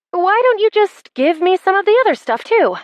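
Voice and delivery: high-pitched indignant whine; exasperated plea. A narrator's reading. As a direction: demand it, pitch shooting way up. Losing player pleads with smug winner.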